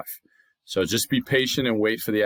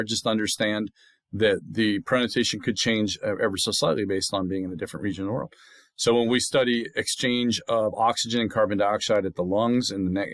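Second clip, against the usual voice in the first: ordinary delivery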